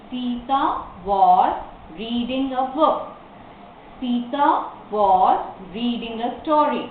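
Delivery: slow dictation with pauses between phrases; word-by-word. Shown as a demonstration. Teacher gives the answers to the class, word by word. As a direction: set each word apart for learners.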